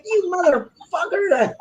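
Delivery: high-pitched exasperated curse at the dog; mock furious curse. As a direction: hit it high and drawn out, fed up. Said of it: "I'm like".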